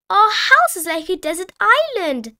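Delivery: put-on high, loud, playful child voice; Peppa Pig. A narrator's reading. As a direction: pipe up excitedly as a little piglet. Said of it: "Peppa laughed".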